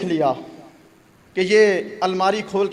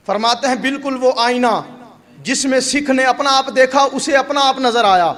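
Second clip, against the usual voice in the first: louder, higher preaching rising to a climax; building sermon; 3 semitones higher, 4 dB louder than usual. Acted. Preacher to the congregation, building preach. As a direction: drive the lesson home, louder and louder.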